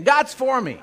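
mean every word; said like you mean it. Shown as a demonstration. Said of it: "say it like you mean it".

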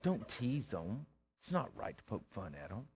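very soft, low shushing reproof; timid, hushed scolding in an acted voice; hushed disapproving bystander. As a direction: hush them like a scandalized churchgoer. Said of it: "If somebody would have said".

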